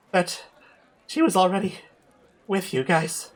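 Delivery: high trembling voice; shaken whisper. Acted. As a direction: tell it haunted. [name] recounts nightmare, shaken.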